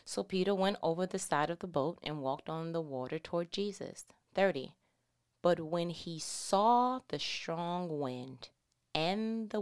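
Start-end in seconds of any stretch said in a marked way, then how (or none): none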